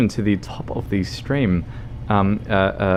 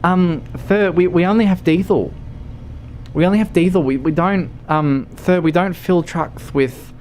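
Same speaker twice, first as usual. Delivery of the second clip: high, hesitant service voice; baffled attendant; 7 semitones higher than usual. Pretend. Attendant tells trucker they only have diesel, flustered high voice. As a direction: play a flustered, polite clerk pitched up high. Attendant refuses moonshine order.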